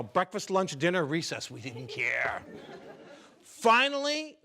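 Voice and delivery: ordinary talk, nothing marked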